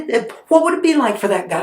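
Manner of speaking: ordinary talk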